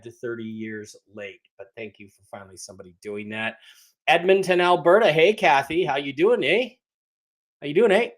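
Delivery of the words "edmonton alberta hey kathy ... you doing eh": lively, louder mock-Canadian greeting; hammed-up Canadian accent. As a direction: greet them with a playful Canadian "eh". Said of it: "People really talk like that".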